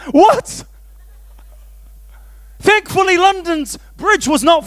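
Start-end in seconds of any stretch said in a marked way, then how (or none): 0.1-0.6 mock alarm. Hears London Bridge song.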